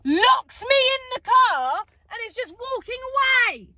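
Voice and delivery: high, loud indignant rant; outraged. Retold or imitated. Indignant retelling, locked in car.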